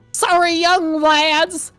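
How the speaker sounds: put-on high, slow old man with a southern accent; drawn-out, hammed-up weary old Southerner; old tired rasp. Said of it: "worn, and tired rasp".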